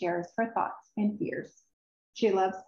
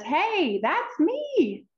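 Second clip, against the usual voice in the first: much higher, squeaky glee; delighted Tilda; 8 semitones higher, much slower than usual. Acted. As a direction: pop up with happy surprise. Tilda recognizes herself, delighted.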